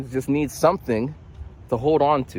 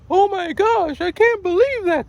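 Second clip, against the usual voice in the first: squeaky falsetto mock outrage; mock shock; 4 dB louder than usual. Mocking the reaction he never has, mock shock. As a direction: act scandalized in a very high voice. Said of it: "I'm matter of fact about things. So there's no".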